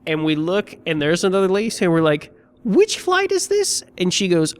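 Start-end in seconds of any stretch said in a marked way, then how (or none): 2.6-3.8 frantic question. Retold or imitated. Frantic traveller asking gate staff.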